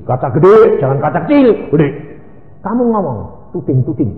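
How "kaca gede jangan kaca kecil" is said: ordinary talk, nothing marked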